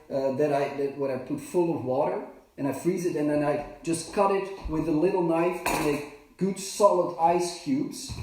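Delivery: normal talking voice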